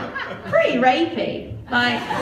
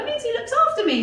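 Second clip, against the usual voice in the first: maid; 10 semitones higher, faster than usual. Retold or imitated. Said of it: "She's like".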